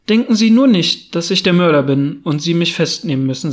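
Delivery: ordinary delivery, nothing marked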